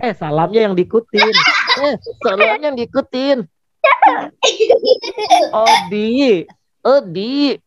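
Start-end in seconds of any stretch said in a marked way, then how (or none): 1.9-3.5 puppet echo. Acted. Puppet Odi mimicking Kak Danang in puppet voice. 5.5-7.5 puppet voice. Acted.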